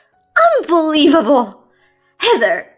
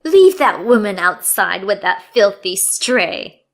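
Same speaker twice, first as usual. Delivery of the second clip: cruel dismissal; 7 dB louder than usual. Acted. Mother-in-law cruel order.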